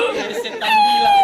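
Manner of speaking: loud and high, shouted through laughter; laughing shout. Real, not acted. The preacher tells the congregation the ghost's punchline in a laughing shout. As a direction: shout it while laughing hard.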